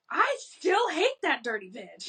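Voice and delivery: high, petty grudge voice; mock childish grudge. Recalling a fifth-grade rival, high petty grudge voice. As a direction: spit it out like a bitter kid. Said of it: "and I'm like".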